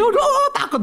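high, laughing whimper of fright; frightened whine with a laugh; mock fear. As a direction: play a scared woman, half laughing.

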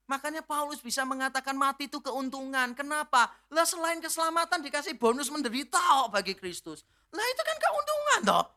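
a preacher climbing in fervour; fervent preaching. Acted. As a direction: lift the congregation with it. Preacher rising again in fervour.

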